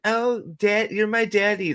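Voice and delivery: high-pitched flirty impression; mock flirty. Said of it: "do the whole flirty thing".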